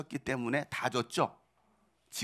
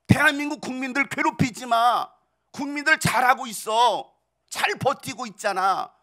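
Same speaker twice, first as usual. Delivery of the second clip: higher, firm protective voice; lightly voiced God, standing up for the people; God defending people; 8 semitones higher, 7 dB louder than usual. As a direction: speak up for the nation like a guardian. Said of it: "하나님이 여러분들에게 그렇게 말씀하실".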